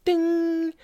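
high bright ding like a desk bell. Retold or imitated. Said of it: "like, oh, like".

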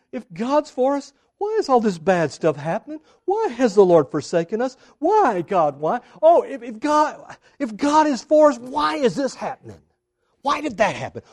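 mock whining, pitched high and building; complaining. Gideon complains to the angel about his troubles, whiny protest. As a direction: wail each why in hammed-up protest. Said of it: "He's complaining".

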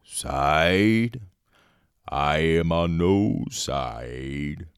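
slow, drawn-out tree voice, rising loud at the end; mock Treebeard. Treebeard answering Merry, slow ponderous mock voice. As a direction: stretch it out like a ponderous old tree. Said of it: "He's like".

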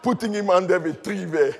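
normal talking voice

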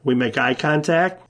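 usual speaking voice

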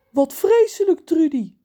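high, loud dismay; shocked sympathy. Acted. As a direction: gasp in sympathy. Reus geschokt. (geschokt medeleven)

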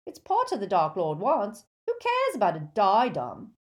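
argumentative henchman. Acted. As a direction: argue back like a dim, stubborn thug. Crabbe argues with Malfoy.

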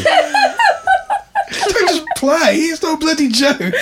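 high, slow, laughing mock telling-off; laughing mock scold.

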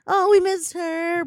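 hammed-up sad fans; mock mourner. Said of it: "everyone will be like".

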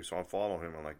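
normal talking voice

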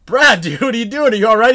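loud, high check on a downed buddy; retold shout of concern; alarmed retold call. Finds friend lying in riverbed.

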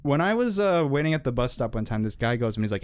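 ordinary talk, nothing marked

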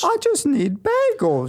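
put-on high, slow whiny kid voice; high childish whine. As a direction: beg your dad like a little kid. Skit: child begging father.

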